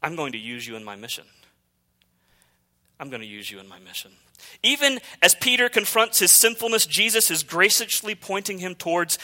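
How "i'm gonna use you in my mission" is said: softer, fast, almost breathed; hushed repeat. Shown as a demonstration. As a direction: let the promise drop to a near hush. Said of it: "repeated line".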